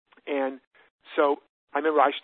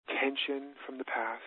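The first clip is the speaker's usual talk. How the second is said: calm, unhurried, with long pauses for breath; guided meditation. Shown as a demonstration. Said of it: "I'll take 'em through a short version".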